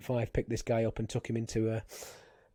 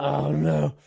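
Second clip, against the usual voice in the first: higher, louder dismay; 4 semitones higher, 8 dB louder than usual. Retold or imitated. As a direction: groan it out in dismay. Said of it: "I was like".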